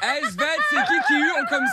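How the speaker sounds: parle en éclatant de rire; en riant. Real, not acted. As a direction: rire aigu en parlant. Le frère rit au téléphone. (voix pleine de rire)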